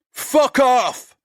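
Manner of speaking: very high, loud screaming curse; furious shout. Acted. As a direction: scream it at him in rage. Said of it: "He's so upset he turns on Sid".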